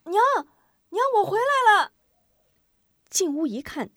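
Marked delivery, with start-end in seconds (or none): 0.0-1.9 put-on high, loud child call. A narrator's reading. Boy calling to his mother, loud. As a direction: call out from outside the door. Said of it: "没进屋就喊".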